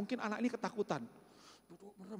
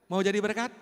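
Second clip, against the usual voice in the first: preacher louder rising appeal; louder repeat; 10 dB louder than usual. Real, not acted. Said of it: "repeated question to congregation".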